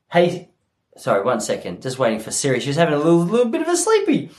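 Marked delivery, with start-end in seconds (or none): 3.0-4.3 rising, high cooing finish; cutesy baby talk. Pretend. Comedian excuses sleepy Siri, mock-cutesy. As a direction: coo as if over a dozy toddler.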